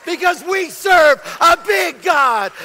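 loud, driving preacher's delivery; fired-up preaching. Real, not acted. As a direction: preach it with full force, punching key phrases. Preaching at full force.

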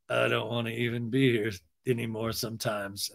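low, soft mock despair; imitated hopeless, deadened delivery; depressed. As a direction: sink into acted gloom. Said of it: "he gets really depressed".

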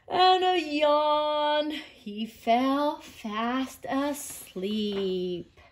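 drowsy storybook wind-down; yawning, sleepy narration. A narrator's reading. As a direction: stretch each word as if dropping off. Sloth yawns and drops off.

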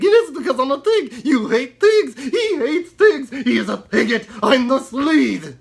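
very high, loud, indignant puppet pig; outraged squeaky puppet accusing; squeaky ventriloquist dummy. Acted.

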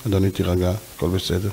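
a calm voice calming an angry man; calm soothing. Acted. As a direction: talk a shouting man down gently. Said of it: "ואתה אומר לו".

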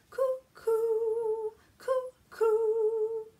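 high loud cuckoo-bird call. Acted. Cuckoo clock call in rhyme.

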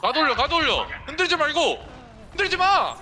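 high shouted orders; urgent shouting. Real, not acted. Urgent yelling at teammate.

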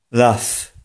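"laugh" said as "laf", with A and a final F; mispronounced love. Shown as a demonstration. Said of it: "Nieprawidłowa wymowa tego słowa może brzmieć". (błędna wymowa: "laugh" jak „laf”, z F na końcu)

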